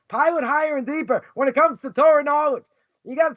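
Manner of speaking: ordinary talk, nothing marked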